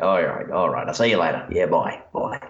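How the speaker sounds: a cheerful bushranger robbing people in a hammed-up Australian accent; Australian bloke. Outlaw robbing people, handing out beers, in light mock Australian. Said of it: "this Australian guy going".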